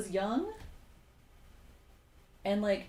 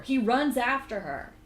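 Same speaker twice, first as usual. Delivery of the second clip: loud, slow, incredulous punch; outraged emphasis; 3 semitones higher, 7 dB louder than usual. Real, not acted. Teller revealing the stalker chased her, loudly outraged. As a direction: raise the voice and stretch RUNS in disbelief.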